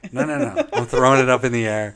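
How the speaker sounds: a laughing, showing-off Night King; mock Night King gloating. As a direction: ham up a cocky villain answering back. Acting out the Night King's answer.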